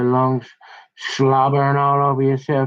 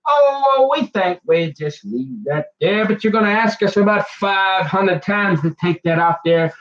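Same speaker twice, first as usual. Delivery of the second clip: mock clerks; 5 semitones higher than usual. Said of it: "they decided".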